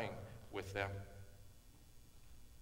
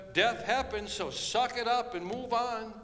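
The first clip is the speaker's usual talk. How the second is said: heartless deity; 7 semitones higher, 6 dB louder than usual. Retold or imitated. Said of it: "coming from a heartless deity".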